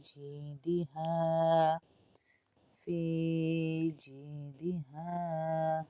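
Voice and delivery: فی held long before جیدہا; madd on fi. Shown as a demonstration. Teacher recites فی جیدہا slowly. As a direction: stretch فی slowly. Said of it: "فی کو اس لیے کھینچ رہے ہیں".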